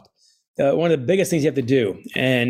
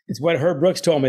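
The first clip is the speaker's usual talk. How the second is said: usual speaking voice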